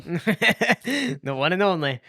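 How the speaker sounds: high, slow laughing repeat; laughing mockery. Real, not acted. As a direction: drawl it out while laughing. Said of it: "laughing mocking repeat".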